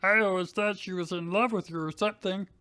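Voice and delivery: Drew voice. Acted. Drew voice continues.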